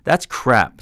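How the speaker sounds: sharp retort. A narrator's reading. Mentor retorting to the man's excuse, sharp. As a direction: cut him down fast. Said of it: "Sai retorted".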